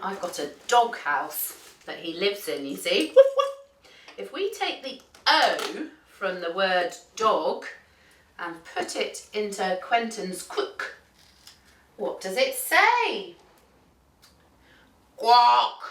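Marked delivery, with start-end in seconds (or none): 3.1-3.5 rapid high-pitched woofs; playful dog barks. Pretend. Storyteller finding the toy dog in the bag, high yapping. 15.2-15.8 "Quack" with an O-like vowel, loud; mispronounced quack. Shown as a demonstration. Duck testing the dog's letter, "Quack" said loudly with an odd O vowel.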